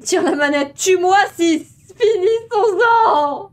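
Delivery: hammed-up wailing plea; mock despair. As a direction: wail dramatically, begging to end it. (désespoir théâtral)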